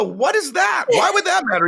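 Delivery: high-pitched, incredulous questioning; baffled protest. Retold or imitated. Beginner asks coach what a hashtag is, baffled. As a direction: demand an explanation in comic bewilderment.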